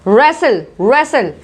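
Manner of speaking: रेसल with no W or T; silent W and T. Shown as a demonstration. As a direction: say रेसल cleanly. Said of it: "रेस्टल भी नहीं बोलेंगे".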